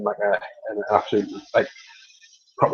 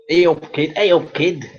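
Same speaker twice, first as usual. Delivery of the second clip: higher-pitched Manchester greeting; sing-song Manchester catchphrase; Manchester accent; 5 semitones higher, 3 dB louder than usual. Shown as a demonstration. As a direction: greet someone in a put-on Manchester voice. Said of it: "That's what they say in Manchester".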